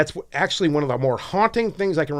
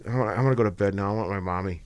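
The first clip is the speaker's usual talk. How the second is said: much softer, lower, defeated mumble; mock whiny despair; 7 semitones lower, 8 dB quieter than usual. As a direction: play a tired kid giving up on the world. Feigning despair, wants mommy.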